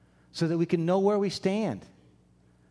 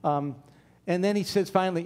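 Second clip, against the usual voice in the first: normal talking voice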